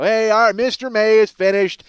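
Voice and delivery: loud, high crowd-clearing holler; bodyguard shout. Retold or imitated. Self-appointed bodyguard clearing fans off [name], mock commanding shout. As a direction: take charge like a self-appointed security man, shouting. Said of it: "be Captain Bodyguard".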